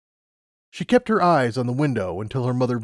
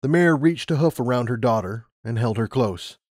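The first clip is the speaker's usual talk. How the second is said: plain reading voice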